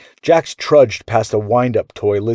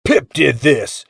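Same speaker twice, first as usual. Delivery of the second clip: slow, raised-pitch booming shout; blasted out loud; 4 semitones higher, slower than usual. A narrator's reading. Gear echoes the boy's mutter, booming. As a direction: blast each word out. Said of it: "blasted back so loud".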